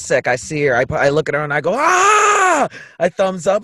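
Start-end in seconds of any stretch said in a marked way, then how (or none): 1.8-2.7 high, loud "aah" of joy; acted ecstatic shriek; elated scream. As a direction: scream out on spotting a friend. Acted cry on seeing a friend.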